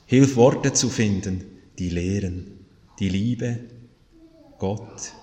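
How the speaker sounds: slow, measured prayer with long pauses; spoken prayer. Real, not acted. Pastor leads the congregation in prayer to God, solemn and slow. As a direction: pray solemnly, each phrase sinking low at its end.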